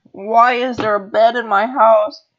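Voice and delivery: high, loud, exasperated yell; loud outcry. Real, not acted. Player finding a bed in the house, yelling. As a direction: cry out the question high and loud. Outburst right after screaming.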